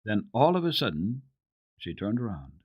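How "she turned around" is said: ordinary delivery, nothing marked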